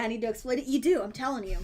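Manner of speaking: ordinary talk, nothing marked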